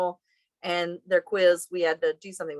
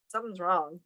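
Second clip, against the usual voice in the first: ordinary talk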